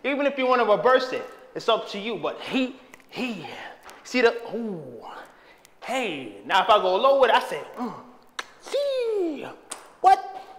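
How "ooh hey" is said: usual speaking voice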